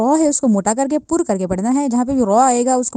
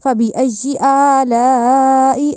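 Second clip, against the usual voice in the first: slow, drawn-out chanted فَبِأَيِّ آلَاءِ; slow recitation; much slower than usual. Shown as a demonstration. Teacher reciting the verse for students. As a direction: recite it slowly, as in tilawat. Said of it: "اس طرح".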